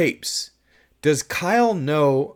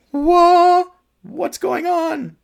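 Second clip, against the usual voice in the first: very high, loud panicked cry; panicked shriek; 7 dB louder, much slower than usual. Shown as a demonstration. Director showing the panic he wanted from Sarah, shrieking. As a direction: shriek it as if out of your mind. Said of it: "I want a little more, like".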